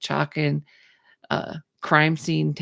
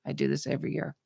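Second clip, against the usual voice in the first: flat store owner; 7 dB quieter, much faster than usual. Retold or imitated. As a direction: shrug it off quietly. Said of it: "the store owner goes".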